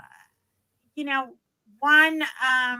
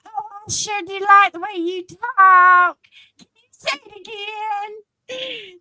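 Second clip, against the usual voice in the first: admiring Southern local; 9 semitones higher, 7 dB louder than usual. Retold or imitated. Texan begs her to repeat it, gushing Southern voice. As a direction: imitate a delighted Texan, pitched way up. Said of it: "Stitches, they will make you say everything".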